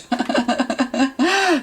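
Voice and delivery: a sudden high giggle at herself; high embarrassed laugh. Real, not acted. Reader laughs at herself after mentioning her ex, a high giggle. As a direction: laugh off the confession about the ex.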